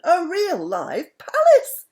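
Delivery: high, loud, drawn-out thrilled cry; great excitement. A narrator's reading. Mister Wumble seeing the palace from the plane, high thrilled cry. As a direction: squeal it with delight. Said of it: "in great excitement".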